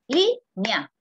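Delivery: línea clapped slowly in two beats, lín-ea; students' syllabification. Retold or imitated. Lecturer imitates students clapping out línea in two beats. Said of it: "palmear las sílabas en la palabra línea".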